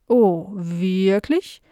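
slow housefly with drawn-out buzzing s sounds; curious housefly. Acted. Fly meeting Nala for the first time, slow and buzzy. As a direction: stretch the words like a dopey, curious fly.

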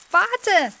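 high, loud squirrel call. A narrator's reading. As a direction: shout from hiding. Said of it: "rief da jemand".

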